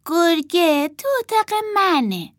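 put-on high-pitched small child's voice; little girl admitting. A narrator's reading. Martha tells about the wolf, high and childish. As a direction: own up in a little girl's voice.

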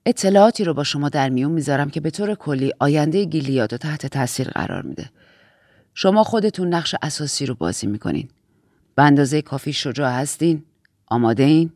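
low-pitched, measured authority; low, grave briefing voice; composed Aunt Lydia figure. Acted. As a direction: speak as the aunt in charge, pitched well down.

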